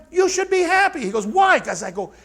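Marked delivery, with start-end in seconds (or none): 0.1-1.0 high, louder acted retort; emphatic reassurance. Coach to frustrated trainee in his office, high insistent encouragement. 1.3-1.6 high, loud imitated protest; mock frustration. Frustrated trainee questioning the coach, mock-exasperated cry. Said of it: "he's all frustrated in my office".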